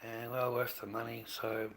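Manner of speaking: normal talking voice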